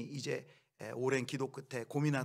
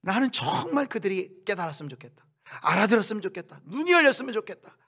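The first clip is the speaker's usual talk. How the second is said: rising, much higher and louder longing cry; lamenting God; 8 semitones higher, 8 dB louder than usual. Retold or imitated. God lamenting over the people who won't understand, pleading ever higher. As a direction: plead as if heartbroken over them. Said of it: "하나님의 마음이 탄식이".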